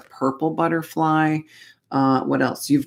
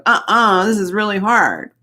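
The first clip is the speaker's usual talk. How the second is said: higher, louder mock whine; whiny Taurus complaint; 6 semitones higher, 3 dB louder than usual. Reader mocks Taurus energy complaining it's too hard, hammed-up whiny voice. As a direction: whine like a sulky kid who finds it too hard. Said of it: "the Taurus energy going".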